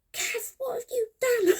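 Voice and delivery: high, loud, wailing dad in horror; shocked dad. Retold or imitated. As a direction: cry out like a father seeing a disaster. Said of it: "he went".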